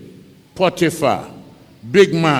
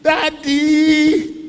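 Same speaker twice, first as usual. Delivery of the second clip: high, loud desperate cries, voice strained; a son dragged away, screaming for his father; screaming for daddy; 10 semitones higher, 7 dB louder, much slower than usual. Acted. Son dragged away from his father's village cries out loudly. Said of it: "ɔteam".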